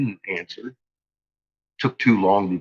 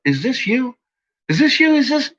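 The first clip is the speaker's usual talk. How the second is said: higher, rising mockery of the nagging question; mocking exasperated questions; 13 semitones higher than usual. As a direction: parrot the pestering question, sarcastic and climbing.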